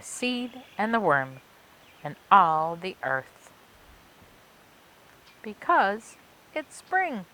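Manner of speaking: put-on lively read-aloud for children; story narration. A narrator's reading. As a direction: tell it like a picture book for kids. Reading a picture book aloud.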